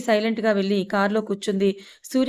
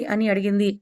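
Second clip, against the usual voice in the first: plain reading voice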